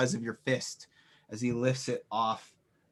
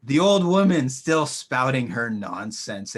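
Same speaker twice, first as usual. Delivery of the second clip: louder, slow contemptuous sneer; scornful villain; 6 dB louder, slower than usual. Acted. Villain mocking the old woman, loud and scornful. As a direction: dismiss her with scorn. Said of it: "he kind of laughs and he says".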